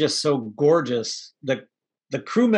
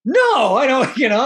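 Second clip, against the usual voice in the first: sharp, much higher and louder retorted no; captain yelling; 9 semitones higher, 6 dB louder than usual. Retold or imitated. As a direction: bark the refusal like a flustered captain. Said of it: "and he's yelling at her".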